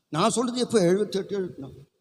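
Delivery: ordinary talk, nothing marked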